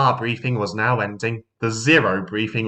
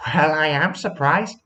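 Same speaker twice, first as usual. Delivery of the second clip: teasing lady voice; 5 semitones higher than usual. Acted. Sally teases.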